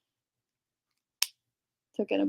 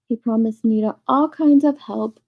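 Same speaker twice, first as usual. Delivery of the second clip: usual speaking voice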